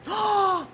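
sharp high gasp of surprise; surprised gasp. Real, not acted. Tarot reader gasping as the same card comes up.

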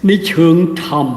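ordinary talk

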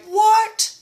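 high incredulous what; shocked what. Retold or imitated. Hearing the strict post, stunned. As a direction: react in disbelief. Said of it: "And I was like".

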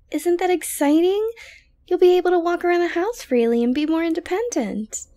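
excited. Acted. Girlfriend eagerly telling her boyfriend about freedom. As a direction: sell it like wonderful news.

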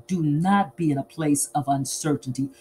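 ordinary talk, nothing marked

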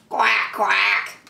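quacky duck noises; playful duck call; duck's quack. Acted. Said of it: "called the duck".

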